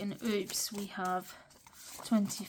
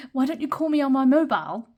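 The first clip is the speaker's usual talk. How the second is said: loud, higher mock British accent, rattled off fast; put-on British accent; 5 semitones higher, 10 dB louder, faster than usual. Quoting a Friends episode to viewers, hammed-up British accent. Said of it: "a British person trying to do a British accent".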